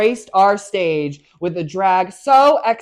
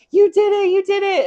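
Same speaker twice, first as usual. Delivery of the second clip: far higher mock cheer; squealing cheer; 13 semitones higher than usual. S1 imitating Kalor cheering at the mistaken result, squealing high. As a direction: squeal it like an excited friend. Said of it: "Kalor with me is like".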